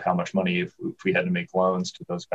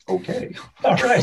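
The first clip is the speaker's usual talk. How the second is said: laughing along. Retold or imitated. Laughing reaction to John.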